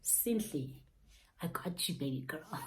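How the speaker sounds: soft, low reassurance; God reassuring. Retold or imitated. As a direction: soothe her like a comforting voice from above. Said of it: "God was like".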